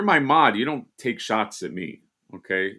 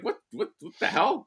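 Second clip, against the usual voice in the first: high-pitched stammered disbelief; mock baffled reaction; retold bewilderment; 10 semitones higher than usual. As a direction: act out being thrown, pitched way up. Retelling own baffled reaction.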